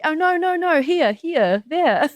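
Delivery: a New Zealander fixing her own American vowels; New Zealand accent. Shown as a demonstration. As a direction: correct yourself into broad New Zealand vowels. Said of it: "I'll just say them like".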